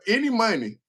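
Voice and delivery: high, cracking up at the end; helpless giggles taking over; laughing. Real, not acted. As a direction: dissolve into real laughter as you finish. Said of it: "I'm laughing now".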